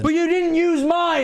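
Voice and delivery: high, drawn-out mock-indignant yell; mock outrage. Streamer heckling the video's call for submissions, mock outrage. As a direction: wail it in comic protest. Jokes over the played video's outro.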